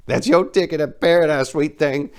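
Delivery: put-on high, loud excited old man. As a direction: point and crow with excitement. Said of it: "pointing excitedly".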